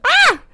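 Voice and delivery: high excited shriek; excited squeal. Real, not acted. Podcaster thrilled at new Eurovision songs, squealing. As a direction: squeal with sudden delight.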